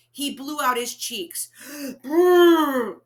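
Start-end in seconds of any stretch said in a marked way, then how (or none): 2.0-3.0 loud gasp and lip blow; huffing breath. A narrator's reading. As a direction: puff out the cheeks and blow. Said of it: "huffed and puffed".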